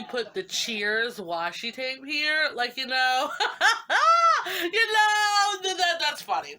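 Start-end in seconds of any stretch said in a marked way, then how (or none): 3.3-4.6 loud, high laugh; squealing laugh; high burst of laughter. Real, not acted. 4.6-6.5 high, loud through laughter; laughing. Real, not acted.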